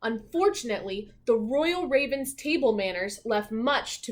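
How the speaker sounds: lively, expressive storybook narration; reading to children. A narrator's reading. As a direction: read it like a bedtime picture book.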